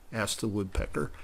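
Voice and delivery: ordinary delivery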